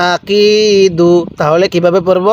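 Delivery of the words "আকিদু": আকিদু very slow, কি held long and level; one-alif elongation. Shown as a demonstration. Teacher showing how to read, drawn-out আকিদু. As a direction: chant আকিদু, stretching the middle কি. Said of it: "আরেকবার".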